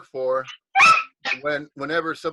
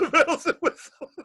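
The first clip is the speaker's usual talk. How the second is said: high, loud words broken by laughter; laughing; 13 semitones higher, 6 dB louder than usual. Real, not acted. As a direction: repeat it while cracking up. Laughing at the innuendo just heard.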